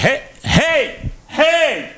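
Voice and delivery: high-pitched hollering, held off the mic; calling out loudly. Retold or imitated. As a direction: holler to get her attention. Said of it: "j'ai tassé le micro, puis je voulais pas défoncer".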